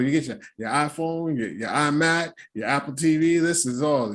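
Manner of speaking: ordinary talk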